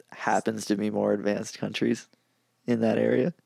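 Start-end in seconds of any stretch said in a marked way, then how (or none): none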